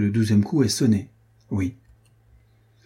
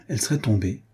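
ordinary delivery

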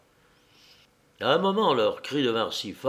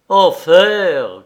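loud, raised curse; angry cry; 6 semitones higher, 12 dB louder than usual. A narrator's reading. Henri learns that a dog is gone, angry cry. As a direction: burst out with an angry oath. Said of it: "cria Henri avec colère".

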